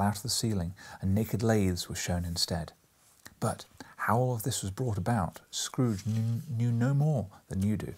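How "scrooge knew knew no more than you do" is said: ordinary delivery, nothing marked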